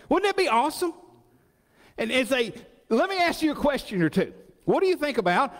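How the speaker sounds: high, fast preacher's enthusiasm; preaching at full force. Acted. Preaching about Abraham.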